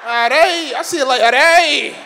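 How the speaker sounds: high, loud, drawn-out mock Scottish; hammed-up fake Scottish accent. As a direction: exaggerate the Scottish accent loudly. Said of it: "with a Scottish accent".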